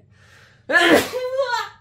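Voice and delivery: loud sudden sneeze; sneezing. Real, not acted. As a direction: let out a big sneeze. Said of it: "je vais éternuer".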